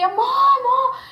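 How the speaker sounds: high, loud cry for her husband; retold panicked scream. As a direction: recreate the desperate scream of "Amor, amor!". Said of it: "e eu gritei".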